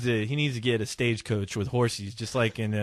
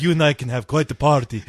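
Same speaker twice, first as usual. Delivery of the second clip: vampire voice; 3 dB louder than usual. Pretend. Vampire voice continues.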